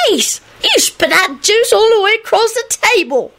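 very high, squeaky excitement; high-pitched gleeful exclamation; excited child. A narrator's reading. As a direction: marvel in a tiny high voice. Child delighted by spat juice.

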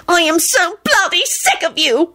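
high, slow screech; piercing exasperation; enraged screech. A narrator's reading. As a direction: screech it, stretching each word. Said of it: "she screeched".

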